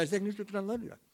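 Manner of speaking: imitating an embarrassed, garbled quick answer; rushed mumble. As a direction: race through it so it can barely be understood. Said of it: "I don't know what you just said".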